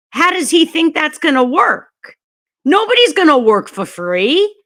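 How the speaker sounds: rising outrage. Real, not acted. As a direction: build up your disbelief into indignation. Rising outrage about free groceries.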